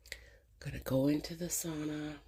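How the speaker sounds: breathy whisper of a grand plan; hushed mock resolve. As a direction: whisper it like a secret scheme.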